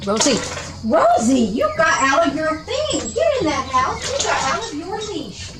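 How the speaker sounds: high-pitched scolding of a runaway pet; alarmed calling to dog. Real, not acted. Dog slipped its leash, calling.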